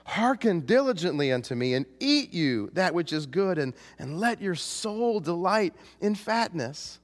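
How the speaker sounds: high, impassioned plea; arguing, beseeching. Acted. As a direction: plead as if arguing with wayward people. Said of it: "and arguing with them".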